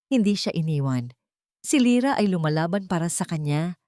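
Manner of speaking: plain reading voice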